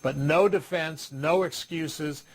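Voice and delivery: each word set down slowly; slow and firm. Shown as a demonstration. Trainer lays down a rule to restaurant staff, slow and firm.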